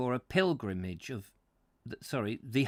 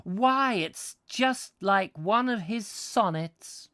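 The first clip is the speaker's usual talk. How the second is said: high, drawn-out American gush; 8 semitones higher, 3 dB louder, slower than usual. Retold or imitated. Tourist marvelling at the view, gushing in an American accent. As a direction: swoon like a starstruck American tourist. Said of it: "This is obviously an American".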